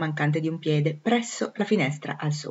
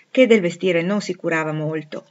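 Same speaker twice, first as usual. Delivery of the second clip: usual speaking voice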